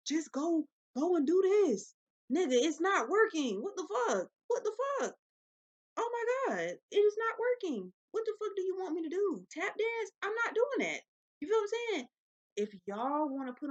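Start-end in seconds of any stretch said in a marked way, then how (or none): 0.1-1.9 slow, high mocking voice of the boss giving orders; mocking quote. Vlogger imitating a boss's orders, mock bossy. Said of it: "Every time you say". 2.3-12.1 high, loud exasperated outburst; exasperated rant. Real, not acted. Vlogger ranting about a job that isn't working, exasperated shout.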